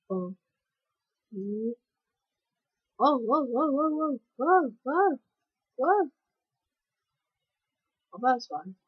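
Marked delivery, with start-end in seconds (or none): none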